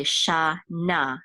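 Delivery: shana drawn out slowly as "sha... na"; syllable by syllable. Shown as a demonstration. Teacher sounding out shana for Hebrew learners, syllable by syllable. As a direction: sound out shana in two slow parts.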